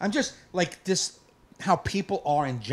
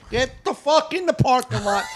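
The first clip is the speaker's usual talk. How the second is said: high, loud shouted order; yelling at a driver; 9 semitones higher, 5 dB louder than usual. Retold or imitated. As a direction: bellow it at the stalled car.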